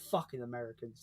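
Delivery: "Fucking Americans" tossed off low and soft; mock-disgusted mutter. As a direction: grumble it under your breath, in jest. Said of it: "like that".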